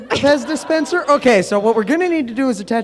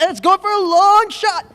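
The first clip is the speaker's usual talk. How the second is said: sportscaster call; 11 semitones higher, 3 dB louder than usual. Acted. Sportscaster call, then dismay.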